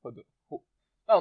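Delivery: usual speaking voice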